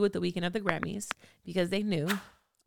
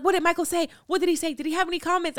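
high, loud, rapid-fire questioning, much higher and louder; pushy reporters; 11 semitones higher, 5 dB louder, faster than usual. Retold or imitated. Reporters swarming her after Michael left, high and loud. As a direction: mimic reporters shoving microphones in her face. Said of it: "They're like".

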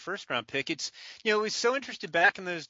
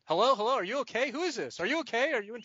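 higher, urgent mock-worried questions; puzzled, concerned phone voice, pitched up; retold alarmed concern; 6 semitones higher, faster than usual. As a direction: imitate someone checking on a struggling caller. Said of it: "somebody's getting, I guess, strangled".

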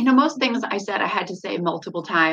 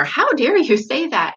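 scolding; 6 semitones higher, 4 dB louder than usual. Retold or imitated. Retold scolding of upset kids.

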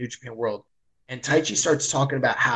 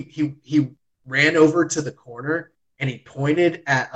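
ordinary talk

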